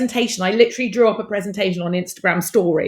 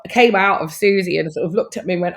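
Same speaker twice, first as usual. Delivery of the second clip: usual speaking voice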